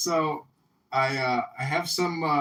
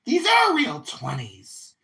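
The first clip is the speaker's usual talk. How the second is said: mock manager; 6 dB louder, slower than usual. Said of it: "he's like".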